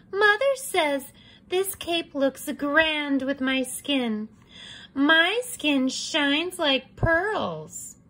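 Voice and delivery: high, slow, sing-song; vain girl voice. Acted. Red admiring her cape, high and sing-song. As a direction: preen like a vain little girl.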